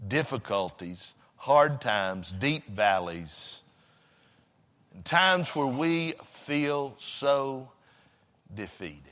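very low, soft pulpit voice with long pauses; hushed preaching. Real, not acted. Preacher telling congregation it's okay to hurt, hushed preaching. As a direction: drop right down and console them gently. Consoles congregation about mourning.

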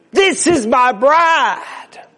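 high, loud, drawn-out announcement; triumphant proclamation. Acted. Christ presenting his bride to the Father, triumphant proclamation. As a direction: proclaim it proudly to the whole room.

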